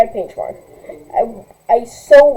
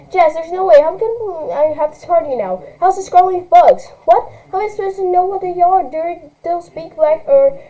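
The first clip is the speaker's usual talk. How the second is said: put-on higher, whining spoiled teen; whiny rich girl; 6 semitones higher than usual. Spoiled girl blaming her mother, lightly whiny. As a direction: complain like a spoiled brat.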